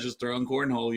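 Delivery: normal talking voice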